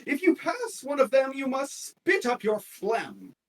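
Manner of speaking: a comic performer reciting to a tavern crowd, hammed up; comedian's rhyme. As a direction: ham up the jester's rhyme for the crowd. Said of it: "and he says".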